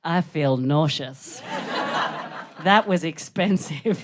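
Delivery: slow, low, sickly complaint; nauseous regret. Acted. Comic at the end of a cigarette, slow nauseous regret. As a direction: let it sag, slow and queasy. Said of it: "the end of the story is".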